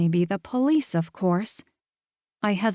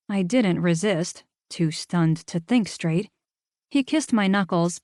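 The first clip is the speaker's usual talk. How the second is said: usual speaking voice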